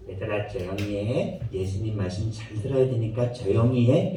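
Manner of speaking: put-on quiet the children during the talk; hushing the kids. 엄마가 아이들을 조용히 시킴. (낮고 작게 아이들을 조용히 시키는 엄마)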